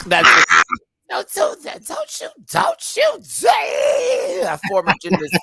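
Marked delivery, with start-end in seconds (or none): 1.1-4.6 very high, slow laughing shriek; high laughing squeal of don't you dare; shrieking laughing warning. Retold or imitated. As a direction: scream it through laughter at him. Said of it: "I'll be like".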